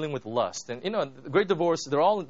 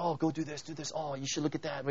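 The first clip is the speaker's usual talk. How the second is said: hushed, coaxing murmur; soft tempting voice at his shoulder; whispering lizard; 7 dB quieter than usual. Retold or imitated. Lizard murmuring temptations to the miserable man. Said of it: "this lizard is just constantly aching at him".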